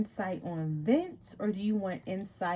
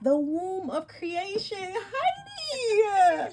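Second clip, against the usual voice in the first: excited shriek; 10 semitones higher, 4 dB louder, much slower than usual. Real, not acted. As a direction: voice breaking into a high cry. Overcome, cries out to friend.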